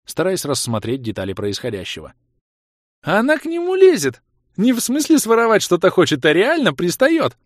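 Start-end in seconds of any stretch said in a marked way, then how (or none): 3.0-7.4 very high, loud shocked squeal; shocked excitement. A narrator's reading. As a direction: squeal in stunned amusement. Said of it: "С поражённым смешком отозвалась Хлоя".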